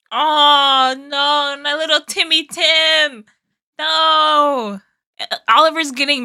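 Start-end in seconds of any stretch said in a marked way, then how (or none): 0.1-4.8 higher, long-drawn moan of sympathy; pitying wail. Real, not acted. Reactor pitying little Timmy Tim, mournful wail. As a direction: lament as if cooing over a hurt darling. Laments for the heartbroken character.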